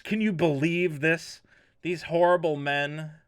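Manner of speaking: normal talking voice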